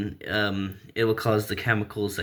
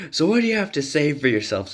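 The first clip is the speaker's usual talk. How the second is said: higher, quick scolding question; stern Bruce; 6 semitones higher, faster than usual. Acted. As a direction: scold them like a disappointed dad, fast.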